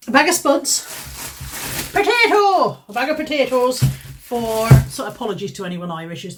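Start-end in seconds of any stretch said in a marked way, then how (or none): 0.1-2.8 hammed-up Irish accent, higher, slowing on the first line; mock Irish accent. Vlogger unpacks her shopping haul, mock Irish accent. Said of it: "Is that supposed to be an Irish accent?". 2.9-4.7 put-on light Irish accent on A bag of potatoes for, slowed; a hint of stage Irish, drawn out; mock Irish accent. Vlogger names the price of her potatoes, mild mock Irish accent. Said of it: "Is that supposed to be an Irish accent?".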